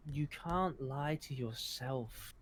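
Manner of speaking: soft, slow and sly; a hint of a creepy Sarah double; eerie mimic as Sarah. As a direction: purr it like a mocking copy. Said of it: "So as Sarah, I'm gonna say".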